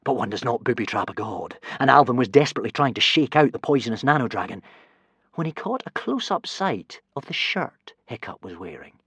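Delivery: ordinary delivery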